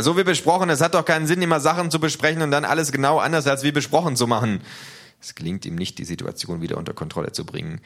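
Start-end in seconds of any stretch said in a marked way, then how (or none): 0.0-4.6 laut und hoch, verzweifelt mahnend; genervter Ruf. Acted. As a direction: schimpf über den Lärm hinweg. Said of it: "ruft er". (lauter, genervter Appell)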